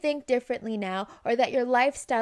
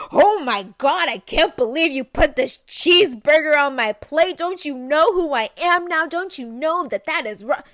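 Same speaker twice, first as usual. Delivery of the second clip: loud, hammed-up indignation; mock outrage; 4 semitones higher, 9 dB louder than usual. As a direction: make a big dramatic scene of being offended. Mock overreaction to cheeseburger.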